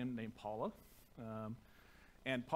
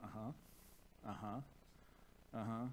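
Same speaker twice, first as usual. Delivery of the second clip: soft, absent-minded uh-huh mumbles; half-hearted uh-huh, much softer; zoned-out uh-huhs; 4 semitones lower, 9 dB quieter, much slower than usual. Retold or imitated. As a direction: mumble it without looking up from the phone. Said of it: "you're going".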